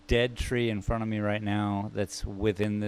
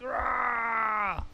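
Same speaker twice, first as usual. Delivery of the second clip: a much higher, strained monster roar; growling tree; 11 semitones higher than usual. Acted. Painter voicing the tree coming at the viewer, mock roar. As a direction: roar like a tree lunging at you. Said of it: "He's like".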